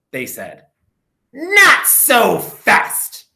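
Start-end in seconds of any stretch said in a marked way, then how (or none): 1.3-3.3 slow, high, loud nasty warning; unpleasant hiss. A narrator's reading. Strict substitute stopping the misbehaving class, slow menacing warning. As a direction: stretch it out like a mean new teacher. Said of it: "hissed an unpleasant voice".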